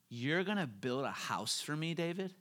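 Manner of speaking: God asks David with a questioning lift; rising question. Retold or imitated. The Lord asks David, questioning rise on David. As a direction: let David hang as a real question. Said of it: "With a question mark".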